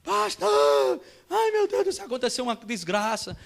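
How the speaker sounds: high, loud wail into the phone, easing off; desperate wife. Retold or imitated. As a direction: cry out to the pastor in hammed-up panic. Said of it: "a mulher dele desesperada". (esposa desesperada, gritando)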